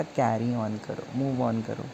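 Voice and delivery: "carry on करो, move on करो" said soft and low, consoling; consoling auntie. Retold or imitated. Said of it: "aunty ने सहारा दिया".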